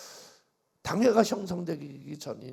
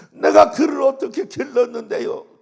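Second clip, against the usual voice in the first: higher and louder anguished question; crying outburst; 9 semitones higher, 17 dB louder than usual. Retold or imitated. Deaconess wailing to the pastor, loud and high. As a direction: wail it out. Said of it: "막 그러면서 통곡을 하고 울어".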